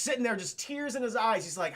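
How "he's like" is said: ordinary talk, nothing marked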